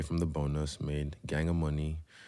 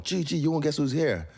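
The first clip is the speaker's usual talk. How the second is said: mimicking fan on phone; 10 semitones higher than usual. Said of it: "He comes over with his fucking phone".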